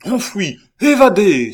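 high, ringing shout of outrage; resounding outcry. A narrator's reading. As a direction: cry it out so every head turns. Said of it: "d'une voix si retentissante". (cri retentissant et indigné)

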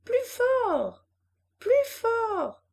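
put-on high, loud cries of a little girl; child crying out. A narrator's reading. Girl on the lawn calling to mother on swing, high loud cries. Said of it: "elle criait".

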